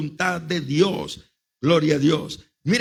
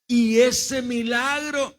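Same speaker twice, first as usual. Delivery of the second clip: high, drawn-out retold greeting; mock surprised greeting; 6 semitones higher, much slower than usual. Host greeting the unexpected visiting pastor, mock surprise. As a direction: imitate a pointed, long-stretched welcome. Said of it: "me encanta cuando me reciben así".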